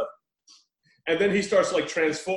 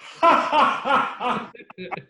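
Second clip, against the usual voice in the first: hammed-up burst of schmaltzy laughter; imitated mad laughing; 7 semitones higher than usual. Host imitating the exorcist descending into madness, hammed-up laughing. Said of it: "And it just starts laughing".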